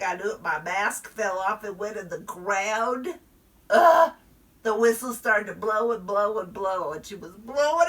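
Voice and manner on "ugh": loud disgusted ugh. Acted. Mask falls in the dirt.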